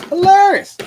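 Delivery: shrieked high and loud, put-on voice; mocking falsetto cry; mock high squeal. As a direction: squeal it like a cartoon. Mocking voice repeats hilarious.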